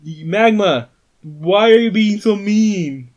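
high, loud scolding call; indignant shout. Acted. Tiny confronts the magma monster, shouting indignantly. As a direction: holler a complaint at the monster.

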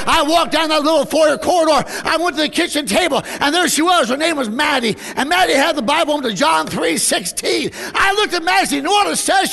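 high, loud, rhythmic sermon delivery; full-force preaching. Acted. Preacher recounting the walk to her Bible, full force. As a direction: preach the story at full cry.

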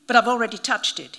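fast indignant objection, pushing back; protesting. A narrator's reading. Priest objecting that he already touched the snake, protesting. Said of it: "he protested".

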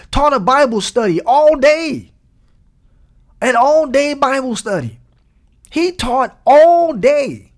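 rising to a shout of praise, drawing out "He taught all day"; full-force preaching. Acted. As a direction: preach it out, the last line slowed right down.

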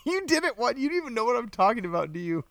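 high, fast giggling accusation; laughing tease. Real, not acted. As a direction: rattle it off laughing at her. Teasing wife, laughing.

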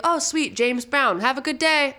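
high, bright mock official waving him in; cheery customs officer. Retelling customs officer greeting a funk DJ, mock cheery high voice.

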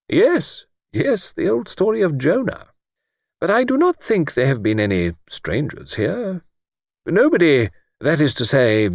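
put-on high, gentle voice of a stooping old clergyman; mild old vicar. Old vicar answering Luke, gently pitched high.

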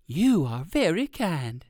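slow thankful mother bird; mama bird voice. Acted.

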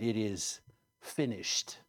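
low, soft, with a pause before the last word; quiet dying words; hushed finality. A narrator's reading. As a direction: let it drop away solemnly. Jesus's dying words, read softly.

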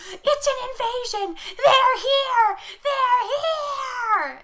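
high, loud, slow frantic shouting; hammed-up panic yell; panicked scream. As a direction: scream it like the monsters are coming. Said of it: "he's like".

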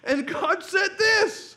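slow it down and lean on each word; slow emphatic build-up. Real, not acted. Build-up before God's answer.

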